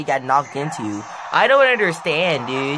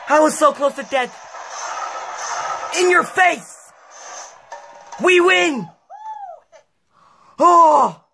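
high triumphant shouts; excited shouting; 12 semitones higher, 4 dB louder than usual. Real, not acted. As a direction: yell in celebration. Celebrating the win.

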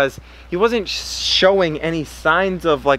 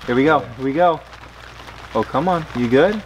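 ordinary delivery

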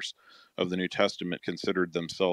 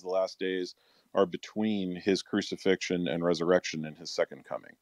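ordinary delivery

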